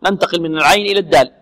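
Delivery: ordinary talk, nothing marked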